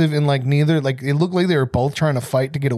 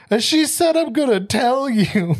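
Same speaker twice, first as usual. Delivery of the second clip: much higher, slow tearful tattle; imitating a high, weepy whine; mock crying; 8 semitones higher, slower than usual. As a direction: squeak it out like a sniffly tattletale.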